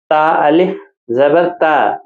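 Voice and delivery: تا drawn out one alif long, slowly; teacher slowly spelling out ta. Shown as a demonstration. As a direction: stretch the a in تا for one beat. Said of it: "تا ایک الف کے برابر کھینچ کر".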